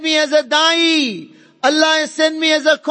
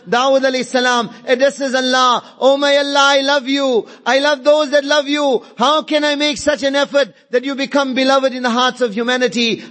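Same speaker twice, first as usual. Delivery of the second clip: usual speaking voice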